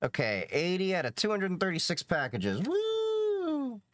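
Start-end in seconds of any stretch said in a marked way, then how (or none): 2.6-3.8 excited woo. Real, not acted. Progress cheer at package count.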